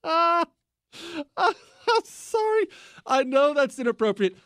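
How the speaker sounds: high-pitched, still recovering from a laughing fit; apologising through laughter. Real, not acted. Host admitting the joke is inappropriate, apologising through laughter. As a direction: squeeze out an apology while laughing. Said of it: "I can't stop laughing".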